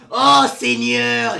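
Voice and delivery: loud, drawn-out mock appeal to heaven; hammed-up invocation. Swearing by the Lord's powers, hammed-up invocation. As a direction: cry out slowly like a theatrical preacher.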